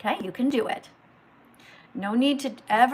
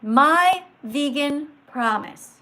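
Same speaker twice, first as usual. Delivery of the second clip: each word drawn out and louder; slow, emphatic teacher reading; 4 semitones higher, 6 dB louder, much slower than usual. Shown as a demonstration. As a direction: read it like a teacher, very slowly with emphasis. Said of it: "So you read it like this".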